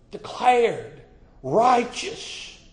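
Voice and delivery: high, ringing declaration; preacher lifting his voice; rising preacher call. Real, not acted. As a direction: lift it up high and proclaim. Preacher climbing to a peak.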